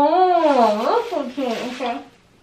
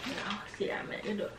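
whispering; 7 semitones lower, 17 dB quieter than usual. Real, not acted. Much softer, unvoiced.

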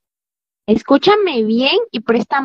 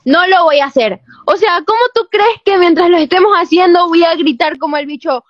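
firm loud refusal; 4 semitones higher, 4 dB louder than usual. Acted. Girlfriend refusing boyfriend's request.

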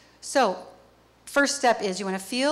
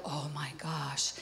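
savoring anticipation; 6 semitones lower, 11 dB quieter, slower than usual. Pretend. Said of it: "And you're like".

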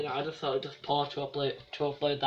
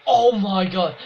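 surprised shout; 4 semitones higher, 10 dB louder, slower than usual. Real, not acted. Sudden outburst at game.

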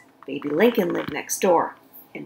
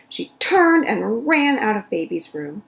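ordinary delivery, nothing marked

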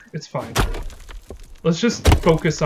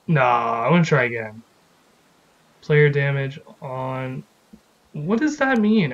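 usual speaking voice

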